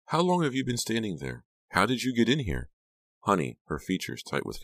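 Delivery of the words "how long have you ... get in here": high, alarmed questioning; startled cry. A narrator's reading. Becca questioning Honey who appeared in her room, startled. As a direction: demand answers in a high, startled voice. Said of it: "Becca cried".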